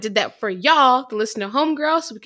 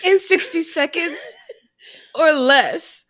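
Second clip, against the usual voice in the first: higher, laughing mock British accent; 5 semitones higher than usual. Cohost finishes the segment intro in a laughing mock British accent. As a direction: finish the line in a giggly fake British accent. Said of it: "this accent's awful".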